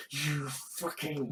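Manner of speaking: low, soft mock seething; muted, low-pitched pretend fury. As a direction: act out pent-up anger, low and under your voice. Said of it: "it's that kind of, like".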